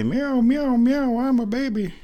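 high-pitched whiny kitty impression; mock a meowing cat in a baby voice; imitating the cat. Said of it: "She'll just sit there and say".

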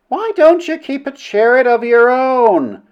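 high, drawn-out heckle; heckler's voice. A narrator's reading. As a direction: call it out slowly from the far end. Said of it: "from a voice at the end of the table".

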